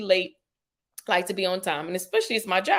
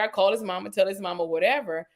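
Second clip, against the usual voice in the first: ordinary talk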